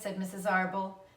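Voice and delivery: ordinary delivery, nothing marked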